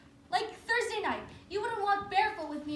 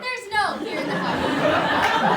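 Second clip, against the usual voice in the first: slow, drawn out through laughter; a wife laughing at her husband's request; 6 dB louder, much slower than usual. Acted. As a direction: laugh the words out.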